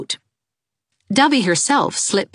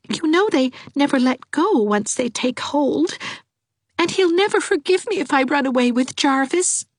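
put-on higher, girlish and pleading; a timid girl begging for help; Dovey's voice; 13 semitones higher than usual. A narrator's reading. Dovey pleading with Anne, higher, girlish voice.

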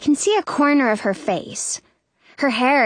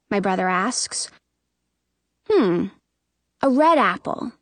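ordinary delivery

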